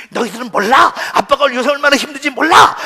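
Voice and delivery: loud, defensive outburst; angry father. Acted. Father answering wife and children about his temper, angry protest. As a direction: protest as the misunderstood dad. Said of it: "왜 이렇게 자꾸 화내?".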